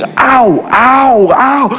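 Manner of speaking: hammed-up little yelps of pain as the blade nicks; mock cries of pain. Pastor reenacting the barber's cuts, mock yelps of pain.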